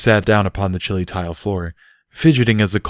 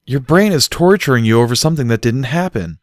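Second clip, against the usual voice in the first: scolding; 6 semitones higher, 5 dB louder than usual. Acted. Barry lectures Bucky.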